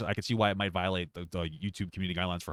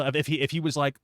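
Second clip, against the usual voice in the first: usual speaking voice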